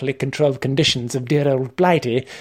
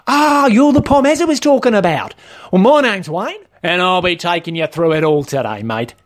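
higher-pitched, over-familiar shop trainer; Wayne, matey trainer; 4 semitones higher, 4 dB louder than usual. Acted. Trainer introducing himself to the new learner, matey. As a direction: greet him as his chummy instructor.

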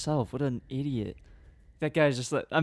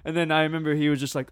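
ordinary talk

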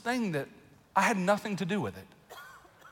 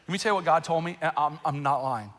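ordinary talk, nothing marked

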